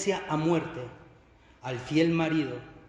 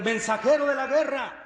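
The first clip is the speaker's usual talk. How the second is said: high, projected announcement; herald's announcement; 7 semitones higher, 3 dB louder than usual. Acted. Herald announces the war messenger to the king, proclaiming. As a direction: call it out like a court herald. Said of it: "lo anuncian".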